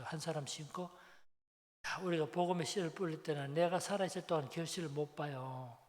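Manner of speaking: normal talking voice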